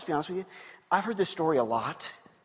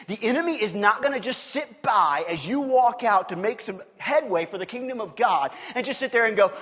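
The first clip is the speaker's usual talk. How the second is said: usual speaking voice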